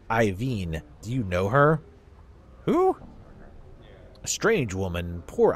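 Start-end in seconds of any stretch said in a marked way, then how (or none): 2.7-3.0 put-on old Southern man, pitched much higher; high, slow Southern old-timer accent. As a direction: play a crusty old Southern dustman in a Southern accent. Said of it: "give old people southern accents".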